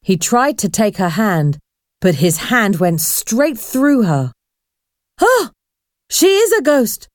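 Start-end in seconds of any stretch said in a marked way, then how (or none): 5.2-7.1 high-pitched exclamation of fright; gasp, then cry out high in alarm; frightened shout. A narrator's reading. Boy's hand went straight through the girl, frightened shout. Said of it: "shouted Paul".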